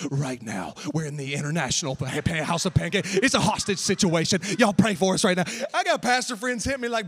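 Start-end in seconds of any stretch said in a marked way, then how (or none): 0.1-5.4 wife's urgent report. Retold or imitated. Wife's urgent live post.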